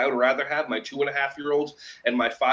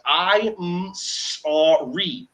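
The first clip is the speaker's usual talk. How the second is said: "I'm sorry" stretched very slow, each sound held long; drawn-out sorry; much slower than usual. Shown as a demonstration. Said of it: "until you say the sounds".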